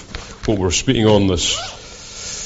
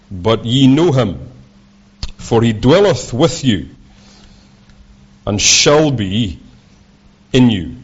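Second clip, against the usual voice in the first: scripture reading; 3 semitones higher than usual. Acted. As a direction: read the verses out like a preacher. Reads John aloud, preaching.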